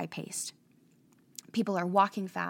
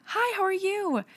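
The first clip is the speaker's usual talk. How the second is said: much higher, sing-song hello; pitched-up cheery small talk; chirpy American greeting; 12 semitones higher, 4 dB louder than usual. Retold or imitated. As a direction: greet a stranger with big friendliness. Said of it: "So we would say".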